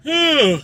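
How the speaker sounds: a real wide yawn. Real, not acted. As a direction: yawn out loud mid-sentence. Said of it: "had to yawn".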